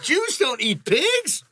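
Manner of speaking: high, slow, drawn-out disbelief; mock shock. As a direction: play dumb with a stretched gasp of surprise. Mock realisation after joke.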